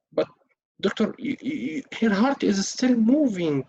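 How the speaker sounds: a panicked daughter clinging to hope; distressed relative. Acted. Acted relative, stammering distress.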